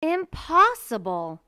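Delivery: loud, high, slow teacher retort; stern teacher scoffing. Acted. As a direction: cut her off with disbelief.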